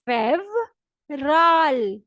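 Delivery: फेवराल very slow, with v not b; Russian fevral. Shown as a demonstration. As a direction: stretch फेवराल out clearly. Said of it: "Russian language में कहते हैं". (फेवराल को बहुत धीरे, साफ़ बोलें)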